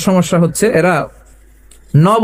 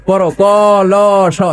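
primary teacher drilling. Retold or imitated. Preacher plays a teacher leading the class, mock spelling drill. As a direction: imitate a teacher calling out letters. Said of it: "primary স্কুলে শিখাইতেছে শিক্ষক".

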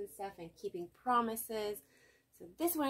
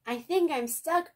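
high, loud worried admission; 9 semitones higher, 7 dB louder than usual. A narrator's reading. Arthur stuck up the tree telling friends, worried. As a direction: sound stuck and alarmed.

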